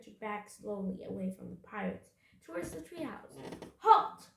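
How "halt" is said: sudden high, loud order; explosive captain's bark; shouted command. A narrator's reading. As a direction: shout to stop them dead. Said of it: "Captain Bones shouted".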